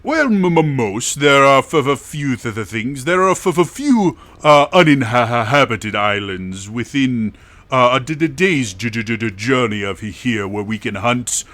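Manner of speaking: booming openings, slowed emphatic phrases; hearty father host. Acted. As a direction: welcome guests grandly to your table. Game master plays the father host.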